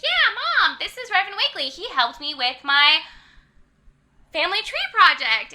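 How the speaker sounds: put-on high, loud little-kid explanation to Mom; imitated eager child. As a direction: pipe up high like a keen child. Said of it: "then Madeline's like".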